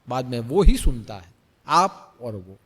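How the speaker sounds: ordinary talk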